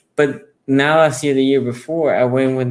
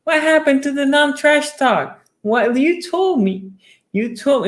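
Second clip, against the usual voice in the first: high, incredulous protest; mock outrage; 14 semitones higher than usual. Ravens fan to the trash-talking lady, in high mock disbelief. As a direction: act stunned at the betrayal. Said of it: "I'm like".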